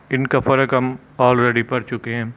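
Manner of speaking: usual speaking voice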